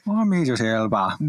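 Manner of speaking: Idris Elba. Acted. Co-host keeps retrying the impression, badly faked English accent. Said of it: "Is that better?".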